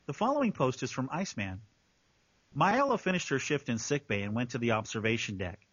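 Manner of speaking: low, measured narrator voice; story narration. Acted. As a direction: read it out as a composed narrator. Reads the post as narrator.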